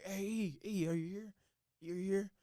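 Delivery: a hint of the bunkmate calling his name from the door; friend calling out. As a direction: imitate the friend searching the room for him.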